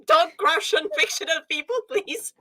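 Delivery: high, half-shouted through laughter; laughing mock plea. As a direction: beg yourself to stop, cracking up. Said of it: "I was like".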